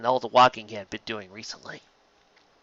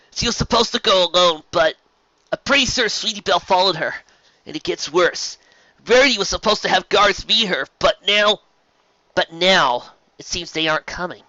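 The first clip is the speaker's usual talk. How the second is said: high, louder worried voice; anxious Twilight; 6 semitones higher, 5 dB louder than usual. A narrator's reading. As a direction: explain in a worried rush. Twilight explains.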